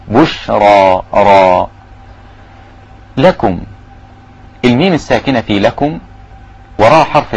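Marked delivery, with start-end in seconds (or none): 0.1-1.7 بشرى then ر alone, both with a thick, heavy ر. Shown as a demonstration. Teacher isolates the heavy ر in بشرى. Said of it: "الراء المفتوحة أو المضمومة تفخم".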